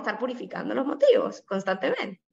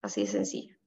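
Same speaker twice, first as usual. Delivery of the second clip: whispered aside; 7 dB quieter, faster than usual. Real, not acted. Preacher whispers the simple point to listeners. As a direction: whisper it, much lower, as a quiet punchline.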